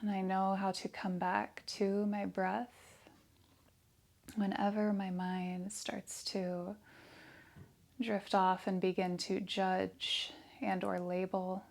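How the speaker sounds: soft, hushed meditation voice with long pauses; guided meditation. Real, not acted. Yoga teacher leading breath awareness and affirmations, soft meditation voice. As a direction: guide them softly into stillness, leaving space after each phrase.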